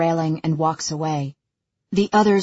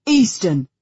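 loud, commanding summons; Callum's commanding voice; 2 semitones higher, 5 dB louder than usual. A narrator's reading. Father calling down another son, commanding. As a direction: rap out the name as an order. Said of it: "Callum's commanding voice bounces off the walls".

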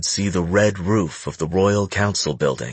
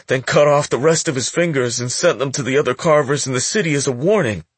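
put-on high, nervous young boy; a scared teen stammering to a gangster; frightened boy voice; 6 semitones higher than usual. Boy continues, bloodied.

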